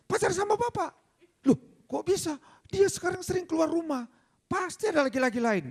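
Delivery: very high-pitched jealous husband impression; accusing husband. Husband accusing wife to her lawyer, high mock agitation.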